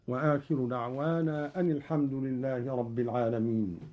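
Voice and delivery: normal talking voice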